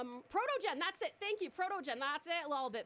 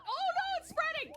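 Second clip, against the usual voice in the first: higher, alarmed outcry; 5 semitones higher, 4 dB louder than usual. Real, not acted. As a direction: panic as the fire spreads. Fire spreads in the game.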